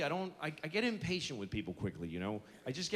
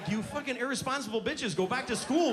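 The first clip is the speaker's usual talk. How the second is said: put-on scolding the girls; 6 semitones higher, 3 dB louder than usual. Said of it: "I'm like".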